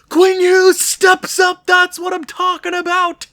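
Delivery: high, loud joyful shout over the goal; excited cheer. Real, not acted. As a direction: celebrate the goal loudly. Goal celebration.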